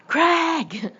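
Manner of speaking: high, loud call across the bay; calling out across the water for Greg. A narrator's reading. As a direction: call his name into the dark.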